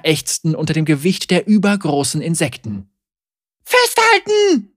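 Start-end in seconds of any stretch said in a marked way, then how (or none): 3.6-4.7 high, drawn-out yell; shouting. A narrator's reading. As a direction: scream it over the noise. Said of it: "schrie seine Mutter von vorne".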